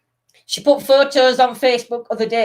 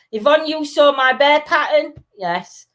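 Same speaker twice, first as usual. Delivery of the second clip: ordinary talk, nothing marked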